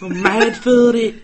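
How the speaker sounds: high, loud, slow mock Manchester accent. Joining in the mate's impression, hammed-up Manchester accent. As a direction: stretch it out in parody Manchester accent. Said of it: "he's doing a main Manchester accent".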